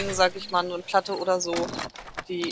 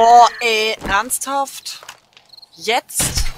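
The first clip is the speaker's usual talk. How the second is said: exasperated; 4 semitones higher, 12 dB louder, much slower than usual. Real, not acted. Sudden interruption in game.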